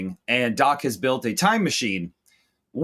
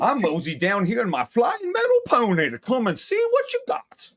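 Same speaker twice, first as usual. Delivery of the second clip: higher, hammed-up folksy voice; mock Doc; 8 semitones higher than usual. Voicing Doc arriving to shop, hammed-up and higher. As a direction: swagger in on a metal pony.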